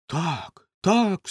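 put-on an old man's excited outcry; agitated old man's cry. A narrator's reading. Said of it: "скрикнув старий, тремтячи з хвилювання".

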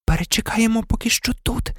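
high, hushed voice; still shaken. A narrator's reading. Hermione deciding to wait in hiding, still shaken. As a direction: speak lightly, as if still rattled. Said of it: "не тямилася від пережитого".

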